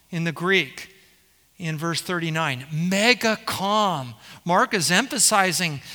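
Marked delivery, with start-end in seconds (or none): 2.7-4.1 "Mega calm" stretched very slowly, each word lingering; slow right down on "Mega calm"; drawn out slowly. Shown as a demonstration. Preacher savouring the Greek for the stilled sea, very slow. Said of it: "Perfectly is mega calm".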